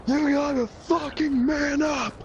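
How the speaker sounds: high, strained motivational shouting; impassioned pep talk. Retold or imitated. Rousing lovesick friend Joe to action, high impassioned shout. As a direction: re-enact a rousing drunken speech at full yell. Drunken inspirational speech to friend.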